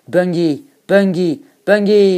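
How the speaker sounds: high shout, building each time; escalating bungee yell; jump cry. A narrator's reading. Jumper's shout before leaping.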